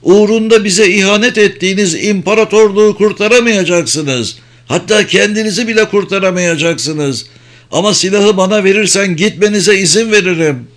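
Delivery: high-pitched, heated, bitter speech. A narrator's reading. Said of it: "dedi katip acı acı". (acı acı, tiz ve hararetli)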